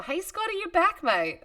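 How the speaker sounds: high-pitched, thrilled hello; delighted greeting. Real, not acted. Streamer welcoming a returning viewer, delighted and high-pitched. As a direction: greet a returning mate with a high, happy lilt.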